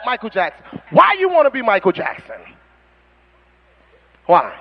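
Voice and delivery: ordinary delivery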